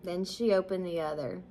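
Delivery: usual speaking voice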